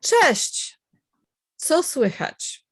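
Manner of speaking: slow, clear reading of "Cześć. Co słychać?"; model dialogue. Shown as a demonstration. Teacher reading the dialogue for students to copy, slow.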